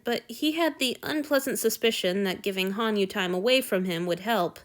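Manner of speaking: ordinary delivery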